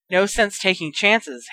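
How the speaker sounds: plain reading voice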